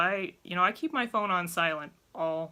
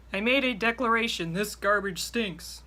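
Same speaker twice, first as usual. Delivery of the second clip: mocking mom's declaration; 4 semitones higher, 5 dB louder than usual. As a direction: parody the hint as a grand announcement. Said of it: "expecting somebody to read your mind".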